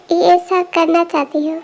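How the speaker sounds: ordinary talk, nothing marked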